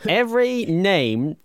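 high, emphatic mock telling-off; playfully exasperated, voice jumping high; mock exasperation. Mock scolding a listener over names.

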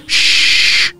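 drawn-out "shh" for silence; long shushing hiss. Acted. Library users hushing a gambler bringing chips in, shushing. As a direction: shush the noisy players. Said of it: "people are like".

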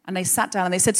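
ordinary delivery, nothing marked

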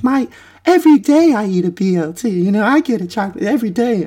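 high, mocking voice of a smug bragger; mocking braggart. Mocking people who brag about their daily treats in a high voice. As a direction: sneer it up high like a show-off.

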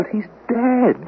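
high, slow, desperate; drawn out, pleading for it to be true; high slow frantic protest; anguished insistence. Acted. Insists the caller is dead.

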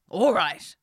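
loud, gruff king; grave old king agreeing; old wise king. Acted. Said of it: "said the king".

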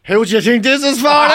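high, loud, laughing through the quote. Retold or imitated. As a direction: laugh while quoting the cheeky man. Quoting the winking man, laughing.

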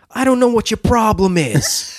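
high, loud put-on New Yorker on the phone; exaggerated loud New York phone quarrel; loud phone arguer. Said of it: "someone saying".

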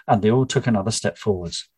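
ordinary delivery, nothing marked

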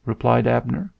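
plain reading voice

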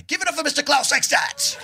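loud, high announcer yell; rousing shout-out. Real, not acted. Singer calls the room to applaud his bandmate, shouting. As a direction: holler it to fire up the crowd.

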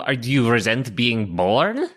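usual speaking voice

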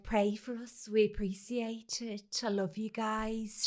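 soft, slow mock-solemn plea; tearful sign-off imitation. Vlogger asks her followers for prayers, mock heartbroken. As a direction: quietly imitate a sad vlogger signing off. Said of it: "she concludes".

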